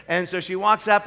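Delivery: usual speaking voice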